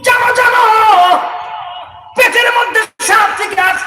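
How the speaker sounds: high, loud sermon shout; a roaring waz preacher calling out over the crowd; fiery preaching. Acted. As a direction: preach it at full force to the crowd.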